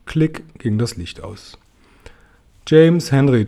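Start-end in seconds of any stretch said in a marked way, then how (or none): none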